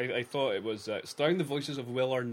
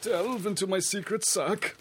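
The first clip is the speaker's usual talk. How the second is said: high, loud put-on character voice; Thelma voice; 9 semitones higher, 5 dB louder than usual. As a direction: ham up a theatrical old-lady voice. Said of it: "do the Thelma voice again".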